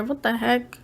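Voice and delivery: ordinary talk, nothing marked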